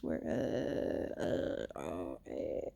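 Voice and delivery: low croaky just-woke-up rasp; morning voice. Real, not acted. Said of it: "still have my morning voice".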